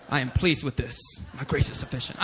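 a hint of God, low-pitched; God's reply. God approving the preacher's accent, lightly solemn low voice. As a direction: pronounce it calmly, low and final. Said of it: "And God says".

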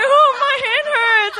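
loud, high mock wail; hammed-up whining over a hurt; whimpering in pain. Fallen rider with a hurt hand, mock wail. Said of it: "I wasn't crying, but I was like".

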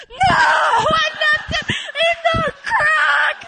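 very high, loud, half crying; desperate yelling. Retold or imitated. Correcting her friend, a high desperate shout. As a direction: insist on the crack.

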